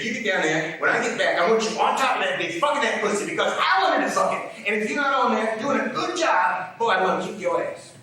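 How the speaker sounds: loud, high-pitched bullying tirade, acted; threatening orders. Father ordering his son, loud and threatening. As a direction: build into a father's hectoring threat.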